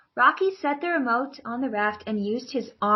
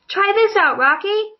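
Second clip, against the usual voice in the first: high, louder, eager call to a friend; excited call; 9 semitones higher, 5 dB louder than usual. Acted. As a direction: call out to him, thrilled to share. David calls out to Rocky.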